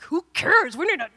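high, loud exasperated interruption; impatient outburst. Retold or imitated. Mad mother cutting into husband's gentle talk, exasperated. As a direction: burst in, mock-outraged.

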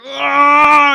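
very high, very loud cartoon monster roar; mock rage roar; hammed-up roar. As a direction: let out a comic Hulk yell. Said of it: "He's like".

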